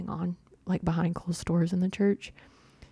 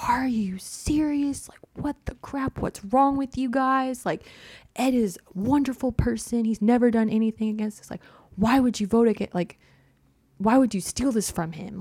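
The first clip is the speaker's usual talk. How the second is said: higher-pitched, incredulous imitation of indignant followers; mock outraged protest; 5 semitones higher than usual. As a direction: protest as the offended buddies, drawing out the disbelief. Buddies' outraged protest, acted out.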